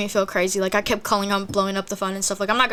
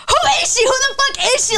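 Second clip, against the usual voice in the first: very high, loud reenacted scream; 5 dB louder, much faster than usual. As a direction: shriek it as a jealous demand, retold. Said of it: "I kept screaming".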